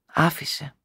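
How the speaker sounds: a soft tearful sigh of a word. A narrator's reading. Tearful wife sighing to her husband, softly. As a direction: sigh it out, close to tears. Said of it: "αναστενάζοντας".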